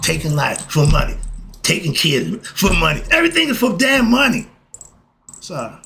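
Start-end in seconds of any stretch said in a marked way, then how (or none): 3.1-4.5 high-pitched angry outburst; real exasperation, pitch jumping up; mad. Real, not acted. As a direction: let the anger boil over. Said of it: "I just got mad".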